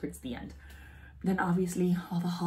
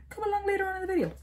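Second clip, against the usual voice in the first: fast; 4 dB louder, much faster than usual. Retold or imitated. Fast aside in high voice.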